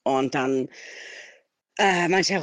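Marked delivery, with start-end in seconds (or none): none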